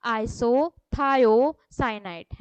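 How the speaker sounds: isothiocyanate stretched out, every syllable held long; very slow for repetition. Shown as a demonstration. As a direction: say it slowly so the class can repeat. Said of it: "मेरे पीछे पीछे repeat करिए".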